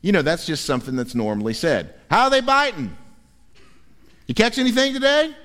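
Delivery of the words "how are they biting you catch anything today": fisherman's call. Retold or imitated. Fisherman's shore greeting.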